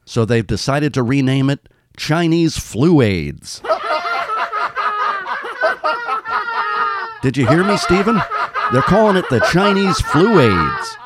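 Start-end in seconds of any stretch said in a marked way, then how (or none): 2.0-11.0 cracking up mid-sentence; laughing. Real, not acted. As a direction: laugh through the words. Laughing at own joke.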